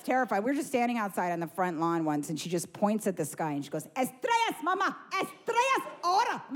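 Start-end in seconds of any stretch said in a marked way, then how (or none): 4.0-6.4 put-on high, insistent Spanish-speaking toddler voice; shrieky mock demand, pitched way up. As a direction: imitate a small child issuing urgent orders. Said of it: "like, Spanish demands all day".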